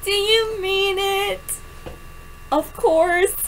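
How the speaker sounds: ordinary talk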